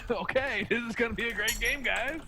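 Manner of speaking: high-pitched fake optimism; mock enthusiasm. Player reacting to teammate's death, mock enthusiasm. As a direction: chirp it high, mock-hopeful.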